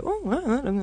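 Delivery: high, baffled stammer of someone unsure; puzzled moviegoer. Retold or imitated. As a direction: sound lost for what to make of it. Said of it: "and go".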